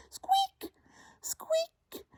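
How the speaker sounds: tiny, very high squeaks; pitched right up, mousy peep; mouse squeaking. Acted. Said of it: "They say".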